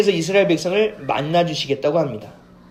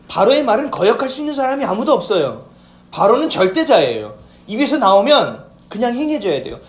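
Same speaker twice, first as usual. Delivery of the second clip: raised, forceful sermon voice; preaching; 4 semitones higher, 5 dB louder than usual. Acted. Pastor preaching to the congregation, forceful. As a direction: drive the point home to the congregation. Preaching about Pharaoh's power.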